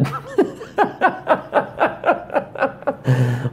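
high laugh. Real, not acted. Laughs at own joke.

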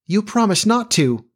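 put-on high, accusing boy's protest. A narrator's reading. Peter reproaching Wendy for growing up, high childish protest. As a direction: pitch it high like a wronged child.

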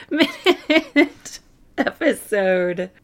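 laughing. Real, not acted. Laughs at not knowing the length.

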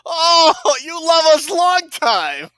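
high, slow mock quote; movie quote voice. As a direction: lightly mimic the film line. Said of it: "Me love you long time".

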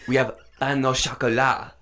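put-on light French accent, laughing through it; a hint of a curt French waiter correcting her; French waiter correcting the order, mild French accent. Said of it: "The waiter looks at her and is like".

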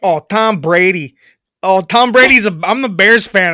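attempted Boston accent turning Chicago Bears fan; brash mock Boston sliding into Chicago; badly faked Boston accent; Boston accent slipping Chicago. Shown as a demonstration. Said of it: "in your Boston accent".

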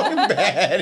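high caveman voice; hammed-up dumbed-down grunt; dumb brute voice. Friend mocking dim Charlie on pills, high and laughing. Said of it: "fake brain pills and he's like".

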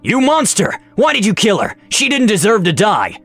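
high, anguished cry of accusation; high-pitched, impassioned dwarf fighter; dwarf's outraged roar. A narrator's reading. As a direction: roar in horror at a killer. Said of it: "the dwarf fighter roared".

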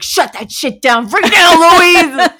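loud, high comic mom order; mock scolding. Mom stops daughter playing sick, mock scolding. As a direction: rise to an exaggerated yell on the name.

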